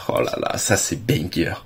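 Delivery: hushed, breathy amazement; soft whispered wow; awed whisper. Real, not acted. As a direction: breathe it out in quiet awe. Awed reaction to perfect card.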